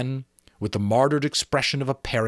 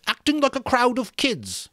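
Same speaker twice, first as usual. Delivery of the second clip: put-on Piggy scorning the boys who ran off; scornful; 14 semitones higher than usual. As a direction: look down on them. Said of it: "he said scornfully".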